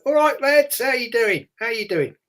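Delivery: high, bright greeting of a friendly player; a hint of a chirpy footballer greeting the kids; cheery footballer voice. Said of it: "And he'd go".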